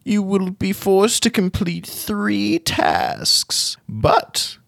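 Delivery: high, slow, ominous gnome wizard warning; old gnome wizard. Acted. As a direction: warn of the curse like a creaky old sage.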